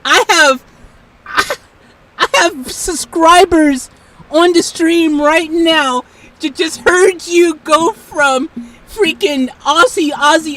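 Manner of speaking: loud, emphatic exasperated outburst; exasperated protest. Real, not acted. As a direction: call it out loudly, leaning on the words. Protesting at the AI's refusal.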